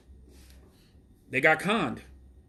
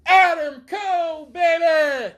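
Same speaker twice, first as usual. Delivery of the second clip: much higher and louder, belting out the name; hyped catchphrase; 13 semitones higher, 8 dB louder, much slower than usual. Acted. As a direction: shout it like the entrance hype for Adam Cole. Hyping wrestler's catchphrase.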